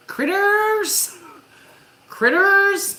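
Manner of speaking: high, loud shrill-free bark of a name; barking orders. Retold or imitated. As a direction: call the pet sharply like an impatient owner. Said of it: "bark orders at Critters".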